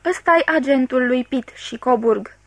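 ordinary delivery, nothing marked

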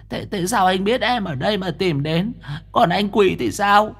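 put-on high, soft shy girl voice; smitten girl voice. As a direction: ask sweetly, flustered. Smitten ghost girl asks rescuer.

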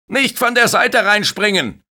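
high, loud reprimand; scolding shout. Acted. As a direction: lifeguard yelling a rule at kids. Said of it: "schimpft er". (Bademeister schimpft laut mit den Kindern)

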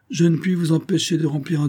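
understated sadness, falling away on the name; sadly. A narrator's reading. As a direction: give reluctant consent with a touch of sorrow. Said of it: "dit tristement le capitaine". (avec une légère tristesse)

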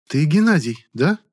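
high, chirpy flirtatious voice; a lightly voiced flirty lady; chirping lady. A narrator's reading. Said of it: "прощебетала дамочка глубоким грудным голосом". (щебечущая дамочка)

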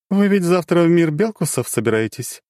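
high, knowing question; dark goddess probing. Acted. Goddess asks.

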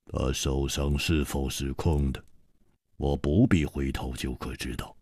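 低沉冰冷的反派嗓音，慢慢吐字; 压低声音，冷冰冰地威胁; 冷冷的黑袍客. A narrator's reading. 黑袍客回应. (黑袍客对楚留香冷冷威胁，低沉阴冷)

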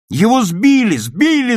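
high, loud protest; children shouting. A narrator's reading. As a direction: yell an accusation for the whole class. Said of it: "закричали ребята". (ребята возмущённо кричат)

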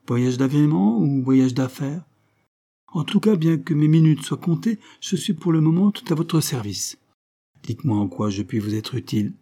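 a hint of a hurried, condescending deputy; busy député. Cousin député, pompous busy voice. (député pressé et condescendant, légèrement joué)

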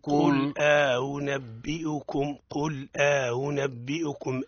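slow قل أنبئكم with the hamza eased; model recitation. Shown as a demonstration. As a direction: recite قل أنبئكم slowly for the student to copy. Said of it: "أعدها". (تلاوة نموذجية بطيئة)

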